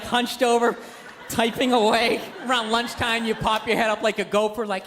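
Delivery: ordinary delivery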